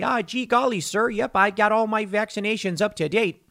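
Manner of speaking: high, folksy put-on Midwestern accent; hammed-up Midwestern yokel, eager to please. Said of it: "too Midwestern of an accent".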